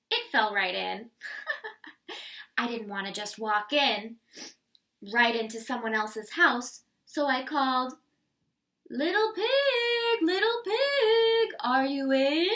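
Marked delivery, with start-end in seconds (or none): 1.1-2.5 breathy laugh. A narrator's reading. Wolf laughs after the house falls. 8.9-12.5 high, slow sing-song call. A narrator's reading. Wolf calls at the door.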